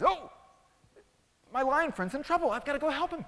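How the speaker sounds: high, loud, urgent cry; alarmed mouse. Acted. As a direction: squeak with urgency to rescue a friend. Mouse alarmed, rushes to help.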